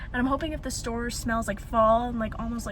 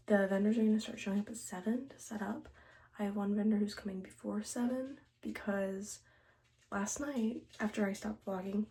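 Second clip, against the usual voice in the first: hushed whisper; quiet at night; 2 semitones lower, 9 dB quieter than usual. Real, not acted. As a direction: keep it hushed, others asleep. Whispers all morning.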